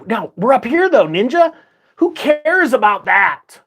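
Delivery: high, drawn-out scoffing question; mock dismissal. Trader playing someone who shrugs off the crash, mock dismissal. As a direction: toss it off high, slowing on the question.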